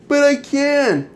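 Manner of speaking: high, slow sulky whine; mock whiny self-pity. Ranter mocking Alyssa's insistence on blame, slow whiny. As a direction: drag it out like a victim wallowing. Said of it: "you can't blame yourself".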